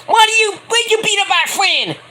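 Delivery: a hammed-up falsetto yell at the attacker; shrieking Britt Baker. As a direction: shriek it like an outraged girlfriend. Britt Baker yells, acted.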